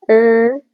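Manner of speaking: र as a short bare sound, not आर; r phonics sound. Shown as a demonstration. Teacher contrasting the letter name आर, bare sound. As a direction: clip र short. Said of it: "ऐसा पढ़ेंगे ना".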